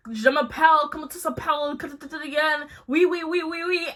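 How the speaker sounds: a lightly voiced mock French chatter. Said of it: "Tim starts speaking in French".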